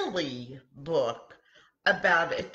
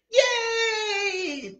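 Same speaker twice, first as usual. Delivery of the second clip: high, loud cheer of joy; excited cheer; 6 dB louder than usual. A narrator's reading. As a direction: shout hooray for recess. Kids cheer recess.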